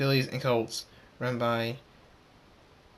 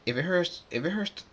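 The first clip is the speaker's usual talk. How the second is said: put-on higher-pitched kid, rising at the end; lightly pitched up like a child; young filly voice; 6 semitones higher than usual. A narrator's reading. Young filly answering her friend, higher child voice.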